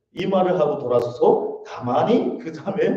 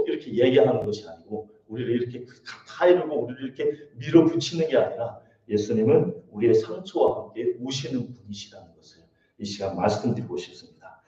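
usual speaking voice

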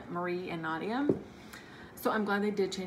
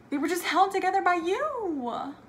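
high, loud swooning repeat; gushing; 11 semitones higher, 7 dB louder than usual. Real, not acted. Fan repeating her favorite line aloud, high and loud with emotion.

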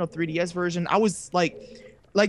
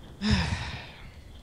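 long, breathy exhale; long sigh; 6 dB quieter than usual. Real, not acted. Before saying sorry to co-host, long sigh. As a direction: let out a heavy sigh.

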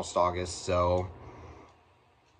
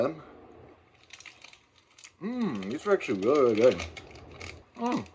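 chewing while talking; speaking around soft candy; mouth full of candy; 4 semitones higher, 3 dB quieter than usual. Real, not acted. Seen 0: Candy goes into mouth at -2; lips move chewing while speaking.

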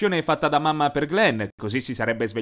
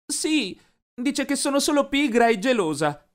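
stammering; 10 semitones higher than usual. A narrator's reading. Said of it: "Fece un cenno e balbettò".

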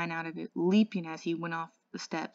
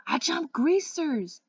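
much higher, louder, slow scream; 10 semitones higher, 7 dB louder, slower than usual. A narrator's reading. Two-Bit screaming his soc boast mid-cartwheel. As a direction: scream the answer out. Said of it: "Two-Bit screamed".

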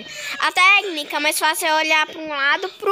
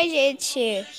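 usual speaking voice

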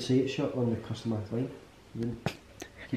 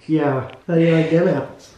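loud, higher mock Boston accent; 4 semitones higher, 9 dB louder than usual. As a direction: do a brash Boston film impression. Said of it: "the Boston, Massachusetts".

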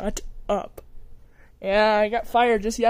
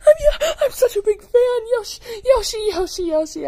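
screaming fan; 12 semitones higher, 6 dB louder than usual. Acted.

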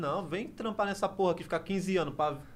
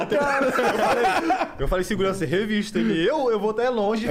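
loud laughing retelling; 5 semitones higher, 6 dB louder than usual. Retold or imitated.

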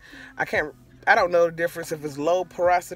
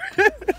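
high laugh. Real, not acted. Laughs at own revenge thoughts.

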